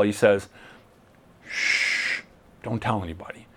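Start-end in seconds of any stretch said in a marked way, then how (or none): 1.5-3.5 whispered shh, then very soft; hushed. Acted. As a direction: hush him like a secret. Jesus hushing the healed leper.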